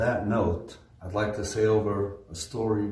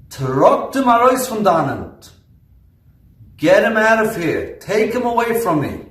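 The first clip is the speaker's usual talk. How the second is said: high, loud commanding yell in Yiddish; sudden yell; 12 semitones higher, 11 dB louder than usual. Retold or imitated. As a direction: bark the order to throw him out. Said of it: "gave a yell".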